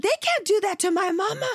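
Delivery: put-on upset child standing up for his mother; son's upset voice. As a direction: protest like the boy defending his mama. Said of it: "he's like".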